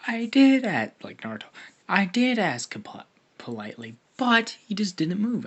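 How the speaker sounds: loud, high, defensive outburst; indignant protest. Acted. Naruto loudly defending himself to Hinata, indignant. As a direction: snap back, offended at being accused.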